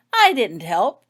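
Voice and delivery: loud protest. A narrator's reading. Painting defending herself to the healer, loud and indignant. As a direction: protest your innocence, loudly. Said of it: "protested Fiona loudly".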